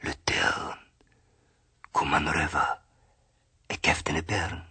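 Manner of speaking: soft, breathed whisper; hushed tree voice in dialect. A narrator's reading. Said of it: "so flüstert der Baum".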